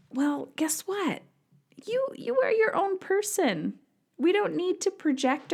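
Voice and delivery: a pointed high-pitched retort; emphatic retort. Real, not acted. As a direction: rise way up to make the point. Retort to women's complaints.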